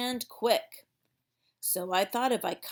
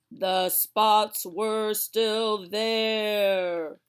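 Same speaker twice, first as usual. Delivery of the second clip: louder, very slow letdown; dramatic reveal; 7 dB louder, much slower than usual. Acted. Girl finding the bandaged spots remain, slow and loud. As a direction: drag it out with big disappointment.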